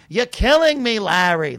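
loud, high put-on catchphrase; that guy. Imitating that guy to a listener, loud and hammed-up. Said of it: "Like, he was that guy".

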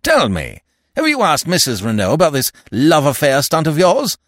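loud, high, forceful; roared. A narrator's reading. Stoner challenging the French officials, roaring. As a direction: challenge them at full volume. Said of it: "roared Stoner".

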